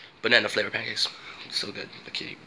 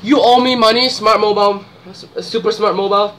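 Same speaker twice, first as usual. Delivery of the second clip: much louder and higher, jokey yell at the brand; mock demanding shout; 6 semitones higher, 9 dB louder than usual. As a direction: bellow it at the company, half joking. Yelling at the brand for money.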